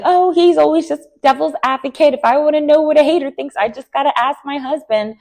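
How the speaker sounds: high, fast, airy brush-off; mock breezy dismissal. Angela brushing off her husband's devil's advocate, airy and high. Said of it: "she played it off like".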